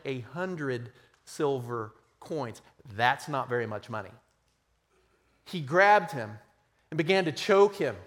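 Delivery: measured, dramatic parable reading; scripture reading. Acted. Preacher reads the parable to the congregation, dramatic reading.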